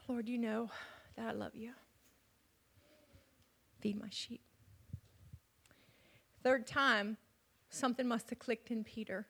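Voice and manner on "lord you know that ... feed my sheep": hushed, pained. Acted. Peter's quiet pained answer.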